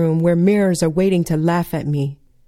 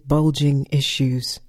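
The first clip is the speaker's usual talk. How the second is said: ordinary delivery, nothing marked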